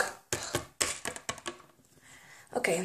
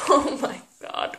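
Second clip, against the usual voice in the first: whispered relief; 2 semitones higher, 4 dB quieter than usual. Real, not acted. Breathless relief.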